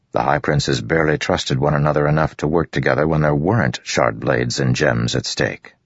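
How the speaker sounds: usual speaking voice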